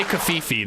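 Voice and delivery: "kafifi" for coffee, as kuh-FEE-fee; joke mispronunciation. Shown as a demonstration. Streamer jokes about own way of saying coffee, "kafifi" as kuh-fee-fee. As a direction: say "kafifi" with an extra "fee", stressed in the middle. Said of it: "that's how I say coffee".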